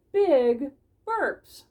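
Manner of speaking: high, loud emphasis; big and loud. Acted. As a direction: blow it up big. Narrator acts big burps.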